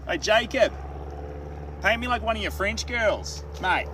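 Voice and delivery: put-on high, loud, broad Australian accent; Aussie accent. Streamer showing how an Aussie would say it, exaggerated Aussie accent. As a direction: call it out like a brash Aussie. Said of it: "But if an Aussie said it".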